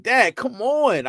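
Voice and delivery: high, whiny mock daughter complaint; teen daughter protesting. Dad mimics his daughter's embarrassed protest, high and whiny. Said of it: "they be like".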